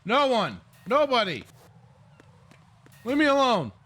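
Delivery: hammy fake Russian accent for a game guard; bad Russian accent. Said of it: "bad Russian accent".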